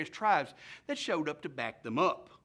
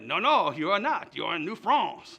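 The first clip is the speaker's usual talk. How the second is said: loud, emphatic mock-French officer; loud, theatrical French commander's rebuttal; 11 dB louder than usual. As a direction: play a French commander firmly contradicting. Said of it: "the French commander was very polite".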